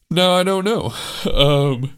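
words stretched through a big yawn; mid-yawn. Real, not acted. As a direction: yawn while you talk. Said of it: "sorry, big yawn".

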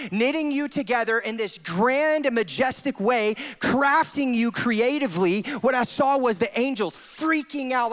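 loud, high, fervent sermon voice; full-force preaching. Acted. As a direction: preach it out with fire.

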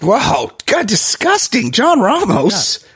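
high-pitched, scandalized protest; mock disgust. Co-host reacting to crude summary, hammed-up disgust. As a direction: play appalled, voice leaping up.

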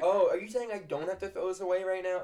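feigned surprise. Retold or imitated. Teacher playing clueless pupil, loud feigned surprise. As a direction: act amazed by the rule.

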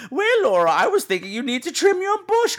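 high, coy genteel lady; prim British lady. Retold or imitated. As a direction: slip in a cheeky innuendo sweetly. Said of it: "the conversations are always like".

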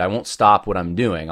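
normal talking voice

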